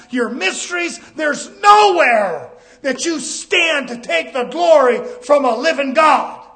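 fervent preacher building a list; full-force preaching. Real, not acted. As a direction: hammer out each phrase at a high pitch.

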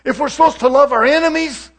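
loud, high, fiery preaching; rising gospel fervour on one phrase; preaching at full force. Acted. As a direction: lift it into a full-voiced sermon cry. Preacher builds to a peak.

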